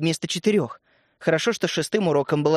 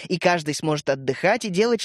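ordinary delivery

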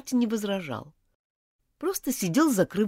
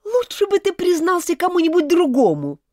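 high, loud angry grumble; grumbling; 12 semitones higher, 7 dB louder than usual. Acted. Elizabeth grumbles at Martin as she stands up. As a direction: grumble it while getting up. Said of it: "проворчала Элизабет".

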